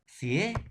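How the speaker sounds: Sierra said clearly with a rising tune; modelled word. Shown as a demonstration. Teacher modelling the word Sierra for students, rising. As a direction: present the word Sierra to the class, rising at the end. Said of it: "tenemos la palabra sierra".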